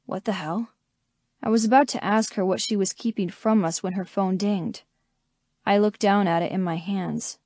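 usual speaking voice

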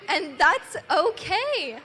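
loud, slow and reassuring. Real, not acted. Children's story moral.

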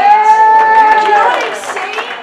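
high, loud and slow; rousing preacher call; preaching shout. Real, not acted. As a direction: stretch it out to the crowd. Preaching at full force.

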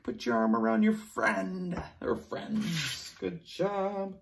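ordinary talk

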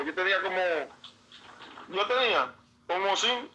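ordinary talk

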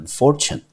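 fortune said clearly, FOR stressed, ending in -chun; saying fortune. Shown as a demonstration. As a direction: model the word fortune for learners. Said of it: "我们就念成fortune".

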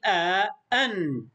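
آ، أن slowly, the second closed on n; "a", then "an" with a held nun; vowel, then tanween. Shown as a demonstration. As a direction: spell out آ، أن slowly for pupils to copy. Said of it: "رددوا معي".

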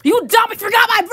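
high, loud angry yell; furious shout. Acted. Birthday kid whose birthday was forgotten, furious shout. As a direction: blow up at the friend at full volume.

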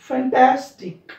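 Fantastic slow, TAS hit loud; slow fantastic with TAS punched; fantastic stressed on TAS; stress before IK. Shown as a demonstration. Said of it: "इकच्या अलीकडे ... stress केलाय".

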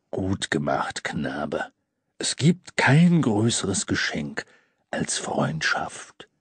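low, slow, wise Santa; deep Santa voice. A narrator's reading. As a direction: praise him gently and slowly. Santa praises boy.

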